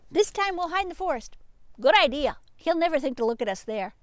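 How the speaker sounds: a sneaky sheep hatching an escape; eager sheep voice. Acted. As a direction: rattle off the plan like a giddy sheep. Sheep characters plotting escape.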